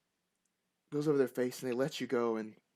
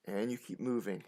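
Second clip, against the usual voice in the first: normal talking voice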